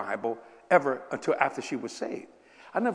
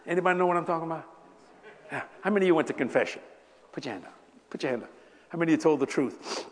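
normal talking voice

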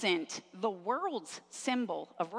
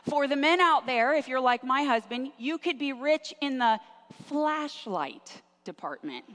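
ordinary talk, nothing marked

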